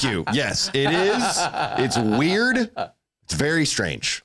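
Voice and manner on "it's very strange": ordinary talk, nothing marked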